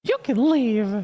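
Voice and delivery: very high, slow and loud; high squeaky mock. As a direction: squeak it back slowly.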